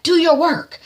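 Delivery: high, loud order; commanding order. Retold or imitated. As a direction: order the man with the bowl. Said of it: "she's like".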